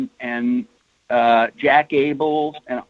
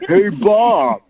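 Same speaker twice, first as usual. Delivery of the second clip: raised mimicking voice, slowing on the last words; bad Larry Hama; 4 semitones higher than usual. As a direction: do a deliberately bad impression, calling out the first words. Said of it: "I'll do my bad Larry Hama impersonation".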